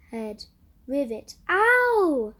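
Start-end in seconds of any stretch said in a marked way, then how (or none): 1.5-2.3 sharp high loud ow; scream. A narrator's reading. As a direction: yelp in pain. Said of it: "the boy screamed".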